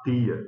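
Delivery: "tia" with a hard t, no ch; pronúncia pernambucana. Retold or imitated. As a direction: quote the Pernambuco "tia", like "tee-a". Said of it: "que estranho". ("tia" com t dental, sem chiado)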